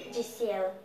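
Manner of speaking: ordinary delivery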